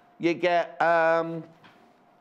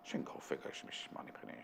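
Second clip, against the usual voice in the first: whispered Connery; 18 dB quieter than usual. Pretend.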